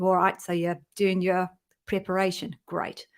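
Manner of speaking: playful attempt at an Australian accent. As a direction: have a go at sounding Australian, just for fun. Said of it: "Here's my Australian accent".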